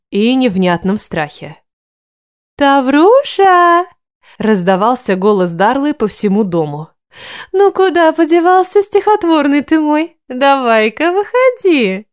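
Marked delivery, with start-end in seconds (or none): 2.6-3.9 high drawn-out cooing call. A narrator's reading. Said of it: "Чуть ли не сюсюкала она". 7.5-12.1 put-on high baby-talk coaxing; cooing. A narrator's reading. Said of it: "Чуть ли не сюсюкала она".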